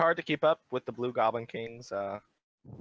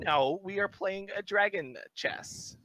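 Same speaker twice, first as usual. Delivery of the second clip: high, slow insistence; high-pitched, haughty goblin rebuttal; stubborn goblin king; 9 semitones higher, slower than usual. Acted. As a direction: draw out the contradiction in a high voice. GM argues back as goblin king.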